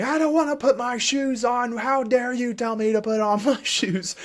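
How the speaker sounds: high, loud mock tantrum, laughing at the end; screaming child. Parent mimicking a child's shoe tantrum, high and loud. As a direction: ham up a screaming kid. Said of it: "screaming and saying".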